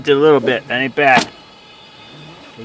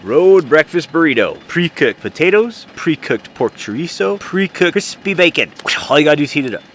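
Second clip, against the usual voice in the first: loud product announcer; loud infomercial read; ad pitch; 8 dB louder than usual. Acted. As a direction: sell the burrito like a commercial. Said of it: "presents his invention like an ad".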